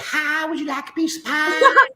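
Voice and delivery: put-on high, loud Kentucky Southern accent; sweet Southern accent. Husband imitating his wife from Kentucky, high sweet Southern accent. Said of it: "That sweet Southern accent".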